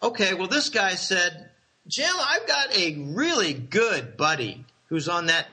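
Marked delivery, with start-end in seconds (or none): none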